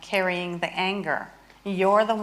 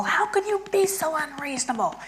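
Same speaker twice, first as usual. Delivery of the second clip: mock outraged pleading; 7 semitones higher than usual. Shown as a demonstration. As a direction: pitch jumps up, tight and strained. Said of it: "so I'm angry at my husband".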